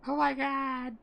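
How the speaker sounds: squealing high fangirl voice. Acted. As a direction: gush like a fangirl. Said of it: "Sound like a fangirl".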